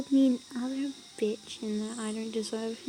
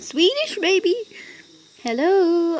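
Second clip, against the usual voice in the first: excited; 7 semitones higher, 7 dB louder than usual. Real, not acted. Excited at Swedish accent.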